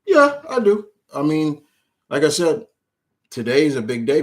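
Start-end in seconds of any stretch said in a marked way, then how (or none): 0.1-0.9 high, loud burst of yes; thrilled answer; excited. Real, not acted. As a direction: blurt it out with pride. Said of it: "how far you come on".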